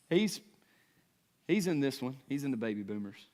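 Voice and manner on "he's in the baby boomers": usual speaking voice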